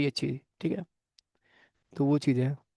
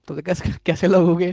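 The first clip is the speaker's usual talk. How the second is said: laughing, louder teasing question; laughing tease; 2 semitones higher, 5 dB louder than usual. Real, not acted. Teacher teasing his class about their smiles, laughing. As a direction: chuckle through it while teasing the class.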